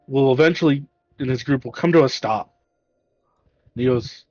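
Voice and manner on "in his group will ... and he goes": usual speaking voice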